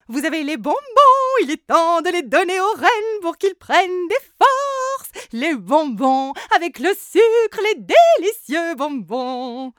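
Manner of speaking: high, loud, sugary voice; sugary candy maker. Acted. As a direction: gush about sweets.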